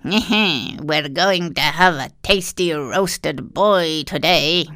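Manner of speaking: put-on slow, gloating old witch. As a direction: savour every word like a greedy witch. Witch gloats over roast.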